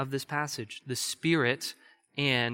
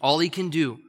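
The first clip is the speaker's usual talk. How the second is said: preaching at full force; 3 semitones higher, 6 dB louder than usual. Real, not acted.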